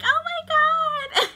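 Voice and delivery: a hammed-up wail from the floor; mock scream. Girl on the ground screaming as she is beaten, mock wail. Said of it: "She was on the ground screaming".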